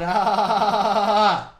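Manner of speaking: loud, high, wavering long cry; long bleating no; emphatic denial. Real, not acted. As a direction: stretch the one word out loudly with a wobble. Drawn-out comic denial.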